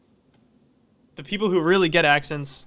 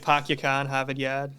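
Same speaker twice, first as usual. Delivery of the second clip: dropped R Boston accent, park your car as "pahk yah cah"; a light Boston accent on request, no R's. Shown as a demonstration. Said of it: "say it Boston".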